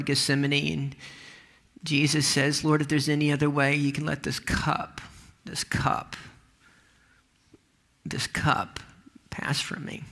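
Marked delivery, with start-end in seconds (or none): 2.6-10.0 long pauses around "this cup", fading softer; halting prayer. Acted. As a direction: pray it haltingly, breaking off and repeating "this cup" almost in a whisper. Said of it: "I think there was a pause".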